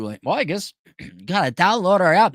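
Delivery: ordinary talk, nothing marked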